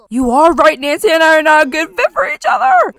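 loud, high, hammed-up outburst; mock shouted agreement. Game player voices a character agreeing to break up, mock shout. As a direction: belt it out as a mock declaration.

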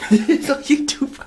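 high, loud gleeful shout; excited. Real, not acted. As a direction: gloat loudly through laughter. Laughing loud announcement.

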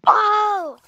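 high, loud sudden yelp; startled cry. Real, not acted. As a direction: jolt in surprise. Killed or hit suddenly.

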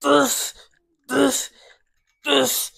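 loud, high bursts on each rep; effortful grunting. Real, not acted. Lifter pumping out biceps reps, effortful grunts. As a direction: force each word out with exertion.

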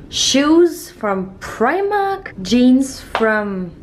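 very slow, stretched words; drawn-out showcase. Acted. Showing off her shoes in a very slow outfit check. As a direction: linger on each word like a runway call.

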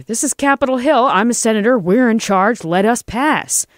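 high, loud senatorial outrage; indignant yell. A narrator's reading. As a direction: yell down the officers, pulling rank. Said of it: "yelled the senator".

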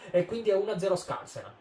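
high, proud score announcement; excited score call. Real, not acted. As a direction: announce the lead with delight.

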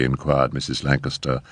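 usual speaking voice

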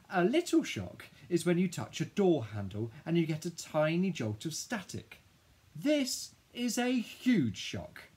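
high, loud indignant rant; outraged disbelief. A narrator's reading. Child stunned by new school news, high outraged rant. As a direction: build an incredulous protest, pitched high.

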